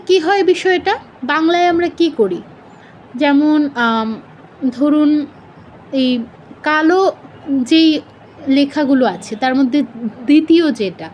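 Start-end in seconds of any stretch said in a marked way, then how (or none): none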